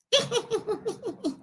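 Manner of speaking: a soft, high gleeful giggle; mouse's gleeful laugh. Acted. Mouse giggling inside the elephant's trunk, gleeful laugh.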